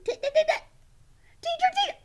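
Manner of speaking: high, loud stuttering squeal; squeaky, stuttered calling out; frantic puppet Sally. Pretend. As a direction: stammer excitedly as a hyper puppet. Puppet Sally calls teacher frantically.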